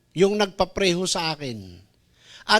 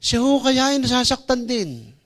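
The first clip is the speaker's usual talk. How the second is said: ordinary talk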